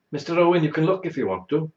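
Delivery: mock-sweet nurse offering him the screen; imitating the nurse. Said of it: "they said".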